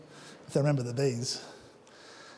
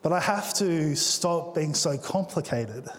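ordinary talk

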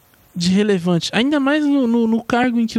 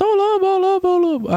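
put-on boy crying wolf; 10 semitones higher than usual. The shepherd boy calling the villagers, mock alarmed shout. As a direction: shout it up high like the boy in the fable. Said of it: "aí ele grita".

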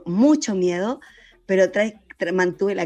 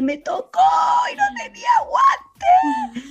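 very high, drawn-out comic shriek; mock panicked wail; 5 dB louder, much slower than usual. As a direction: wail it in exaggerated horror, stretching the end. Retold panicked thought about covid. (chillido agudo de pánico exagerado)

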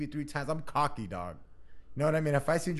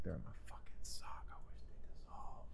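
whispered, much softer confession to himself; guilty whisper; 9 semitones lower, 14 dB quieter than usual. Retold or imitated. Kid caught stealing whispers to himself about the sock. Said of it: "And you're like".

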